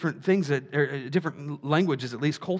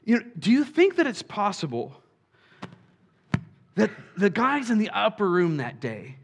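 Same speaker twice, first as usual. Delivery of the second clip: usual speaking voice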